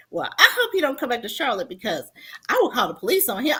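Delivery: fast firm mother; a hint of angry mom; quick threat. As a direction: rattle off the warning.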